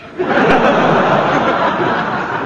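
high airy laugh; breathy laugh. Acted. Inspector laughs at the wallet.

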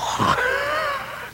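high hooting laugh; short hooting laugh; big engine laughing. Acted. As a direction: laugh off the little one. Freight engine laughs again.

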